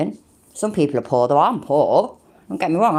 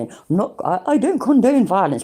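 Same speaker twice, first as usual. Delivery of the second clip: prim, higher YouTuber voice; mock YouTuber disclaimer; 7 semitones higher, 3 dB louder than usual. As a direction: parody a preachy YouTuber. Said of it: "the whole YouTuber thing where I say".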